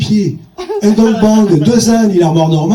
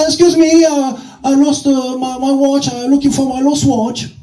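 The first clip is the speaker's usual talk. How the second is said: higher, slow, halting English tourist; 7 semitones higher than usual. Retold or imitated. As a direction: play a lost English tourist hunting for his watch. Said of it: "un touriste anglais".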